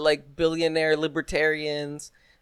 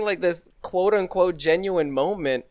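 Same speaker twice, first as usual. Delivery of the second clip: ordinary delivery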